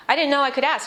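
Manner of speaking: normal talking voice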